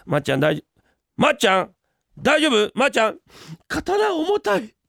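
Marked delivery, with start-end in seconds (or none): 3.7-4.8 whining, near tears. Acted. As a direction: whine like a worn-out kid. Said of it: "泣くんじゃないよ". (泣きべそで弱音を吐く)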